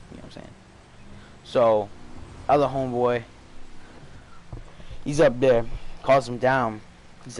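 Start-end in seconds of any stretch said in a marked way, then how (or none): none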